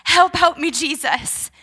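high, loud cry for help, dropping off; retold yell. Injured swimmer calling on Jesus for help, retold yell. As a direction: re-enact a desperate call to Jesus. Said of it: "and I yelled out".

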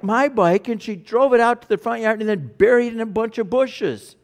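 put-on a boy complaining to his parent about his sister; indignant child. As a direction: tattle back like an upset little boy. Boy voiced complaining loudly.

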